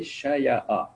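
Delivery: شیعہ slowly as sha-ya-a; original form shown. Shown as a demonstration. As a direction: separate the syllables of شیعہ, sha, ya, a. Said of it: "اصل میں تھا یہ". (شیعہ کے تینوں حصے الگ الگ، آہستہ)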